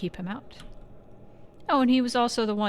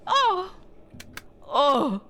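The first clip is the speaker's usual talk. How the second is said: startled cry; 9 semitones higher, 3 dB louder than usual. Acted.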